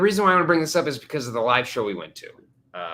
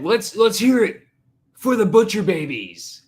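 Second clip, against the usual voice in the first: loud, slow, high crowd call; imitated stage hype; 8 semitones higher, 8 dB louder, slower than usual. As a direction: rouse the crowd like a band frontman. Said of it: "every band that came out ... said".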